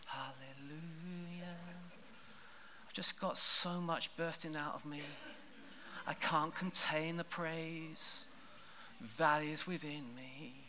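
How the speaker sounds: soft, slow and half-hearted; limp, quiet hallelujah. Shown as a demonstration. Preacher mocking a timid church praise, quiet and slow. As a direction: mumble the praise with no life in it. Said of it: "That's what the word hallelujah means when we sing".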